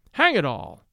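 plain reading voice

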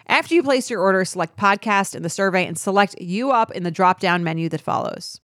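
upbeat sponsor read. Acted. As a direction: sell it like a host ad. Host-read Skims ad.

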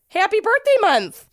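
high, bright birthday cheer; excited. Real, not acted. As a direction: sing it out with glee. Greeting the fan's birthday.

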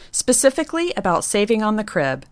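ordinary talk, nothing marked

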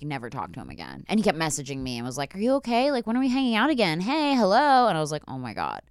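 usual speaking voice

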